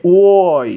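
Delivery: ওয়াই stretched slowly from a long "o". Shown as a demonstration. Teacher exaggerating the Y for learners, long rounded "o". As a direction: hold the rounded opening of ওয়াই. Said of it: "ও দিয়ে".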